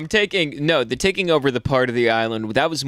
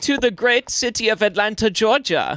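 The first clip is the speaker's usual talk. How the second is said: high-pitched mock Swedish accent; eager exchange student with put-on Swedish accent; Swedish kid accent; 11 semitones higher than usual. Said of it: "blue-eyed Swedish".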